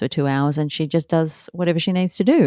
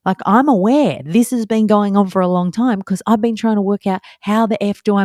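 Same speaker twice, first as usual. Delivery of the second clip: higher, louder exasperated rant; heated outburst; 6 semitones higher, 3 dB louder than usual. Retold or imitated. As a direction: let rip at the nosy strangers. Confronting strangers on the landing.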